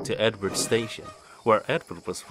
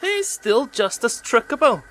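high, louder mocking jibe; teasing; 13 semitones higher, 5 dB louder than usual. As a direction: needle him playfully. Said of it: "teased Ben".

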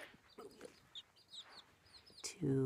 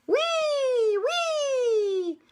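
very high, loud drawn-out squeals; gleeful cries; 9 dB louder than usual. A narrator's reading. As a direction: squeal like chicks zooming down a slide. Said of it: "cried the little chickens".

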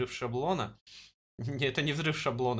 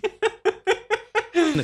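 shrieking burst of laughter; loud high laugh; 10 dB louder than usual. Real, not acted. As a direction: howl with laughter. Laughs at pun.

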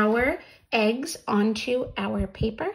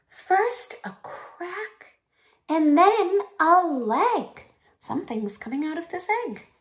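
gentle storytime voice with a breathless gasp; reading to children; 6 semitones higher than usual. Acted. Reading picture book to kids.

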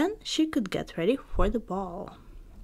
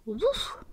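higher mock woof; dog bark; 7 semitones higher than usual. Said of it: "Bruno barked".

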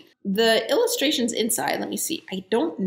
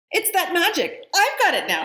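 cried out; 10 semitones higher than usual. Acted. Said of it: "she cried".

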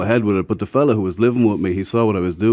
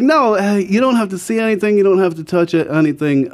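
high coaxing quote; 10 semitones higher, 3 dB louder than usual. Retold or imitated. Dealer's persuasive offer retold.

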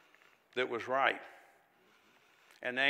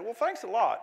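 mock-wounded thanks, higher; light sarcasm; sarcastic thanks; 8 semitones higher, 6 dB louder than usual. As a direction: throw it back sarcastically. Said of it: "That's what I told him".

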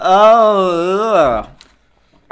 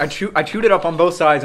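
ordinary delivery

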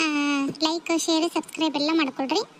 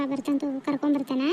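usual speaking voice

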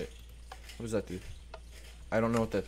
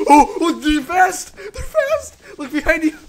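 high, loud, laughing panic; panicked laughing; 13 dB louder than usual. Real, not acted. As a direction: squeal through laughter while fleeing. Chased by fast mobs, panicking.

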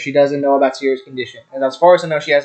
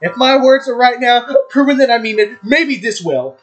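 dramatic reading; 8 semitones higher than usual. Acted. Vowing to keep Natsuki safe, hammed-up dramatic passion. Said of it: "that dramatic performance".

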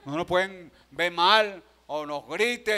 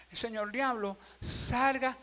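soft, higher, timid mock rebuke; cobarde imitado; 6 semitones higher, 3 dB quieter, slower than usual. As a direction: mimic a coward feebly ordering the devil out. Mimics a coward rebuking the devil. (reprensión tímida, en voz bajita, fingida)

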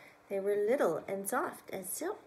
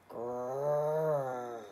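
deep rolled growl; low growl; 8 semitones lower than usual. Acted. Reader growling as the bear.

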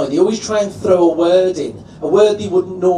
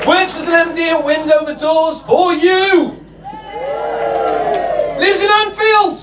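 much higher, shouted big introduction; announcer hype; 12 semitones higher than usual. Real, not acted. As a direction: bring the act on like a boxing announcer. Introducing first act.

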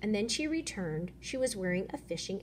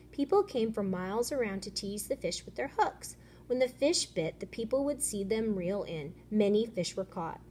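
usual speaking voice

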